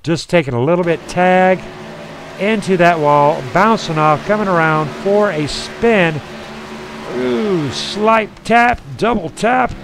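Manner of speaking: animated crash commentary, rising at the end; live motorsport announcer; race play-by-play. Real, not acted. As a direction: call the wreck as it unfolds.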